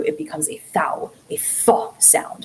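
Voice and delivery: "thou" and "thaw" whispered with a soft th. Shown as a demonstration. Teacher showing the old Middle Eastern th sound in a whisper. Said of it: "Middle Eastern dialects".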